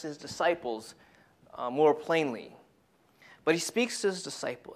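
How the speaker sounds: ordinary delivery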